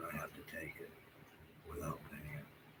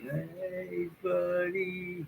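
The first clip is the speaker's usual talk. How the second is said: mocking, much higher sing-song "Hey, buddy"; fake-friendly greeting; 11 semitones higher, 7 dB louder, much slower than usual. Mimicking neighbours who call him buddy, sugary and mocking. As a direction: parody their sugary friendliness. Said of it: "they're still nice to me".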